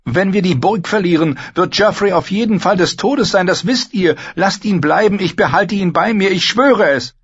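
high, loud, urgent begging; pleading. A narrator's reading. Knight begs the queen to leave the king, pleading. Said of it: "flehte Sir Lancel".

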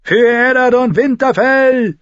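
loud high charging war cry; rally the men as you charge; battle cry. A narrator's reading. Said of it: "rief eine Stimme".

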